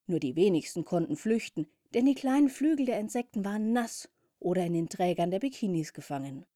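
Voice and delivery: usual speaking voice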